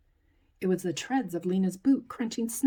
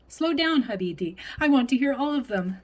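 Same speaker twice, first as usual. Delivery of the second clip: ordinary delivery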